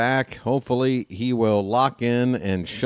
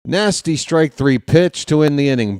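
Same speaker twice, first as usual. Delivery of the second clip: ordinary talk, nothing marked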